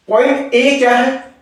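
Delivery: usual speaking voice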